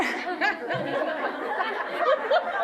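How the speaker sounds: a quick real laugh, breaking off the story; real burst of laughter. Real, not acted. Speaker laughs as the audience sees her picture, real laugh.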